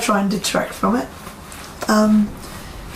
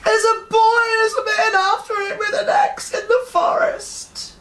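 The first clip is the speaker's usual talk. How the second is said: put-on high, tearful child's voice; a scared little girl spilling out the dream; frightened child; 12 semitones higher than usual. Daughter telling her mother about the nightmare, high and tearful.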